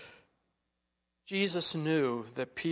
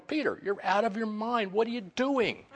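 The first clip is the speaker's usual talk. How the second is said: higher, incredulous mock protest; retold alarm; 7 semitones higher than usual. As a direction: call out as if he's crazy. Retells disciples' alarm at Peter.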